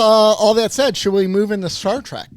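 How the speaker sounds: usual speaking voice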